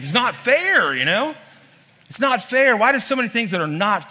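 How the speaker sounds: it's not fair, pushed up high and loud; rising protest. Real, not acted. Pastor protesting to the congregation, high loud outcry.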